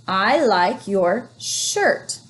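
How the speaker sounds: I like your shirt read very slowly; teacher modelling slow reading; slow reading aloud. Shown as a demonstration. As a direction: read each word slowly. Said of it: "modelling smooth reading".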